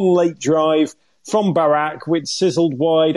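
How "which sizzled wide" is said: ordinary delivery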